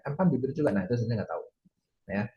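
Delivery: ordinary talk, nothing marked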